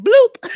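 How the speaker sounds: high, loud cartoon bloop; mock bloop sound. Susie's sound as Tia gets removed, high loud bloop. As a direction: pop it out like a cartoon exit. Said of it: "Susie was like".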